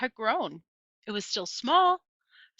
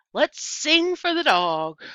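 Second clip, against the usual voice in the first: put-on high, slow little-girl voice; small girl's plea; 8 semitones higher, 7 dB louder, slower than usual. A narrator's reading. Sweetie Pie begging everyone to sing, high and slow. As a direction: draw it out like a small child.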